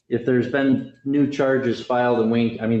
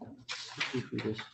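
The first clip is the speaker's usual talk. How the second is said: very soft, low murmur; quiet aside; 5 semitones lower, 19 dB quieter than usual. Real, not acted. Judge to self between cases, in a very quiet murmur. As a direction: talk under your breath.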